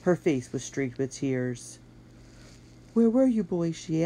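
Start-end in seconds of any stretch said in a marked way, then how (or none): 2.9-3.7 upset woman. Acted. Tearful mistress demands.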